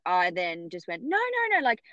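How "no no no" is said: panicked refusal. Retold or imitated. Refusing the cesarean, retold.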